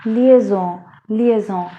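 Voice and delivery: liaison as French lee-ay-zon, nasal ending; French pronunciation. Shown as a demonstration. Teacher models the French word liaison. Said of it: "it is called".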